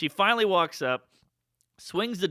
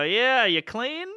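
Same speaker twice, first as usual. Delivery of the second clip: retold awkward question; 8 semitones higher, 5 dB louder, much slower than usual. Naive teen asks awkward question.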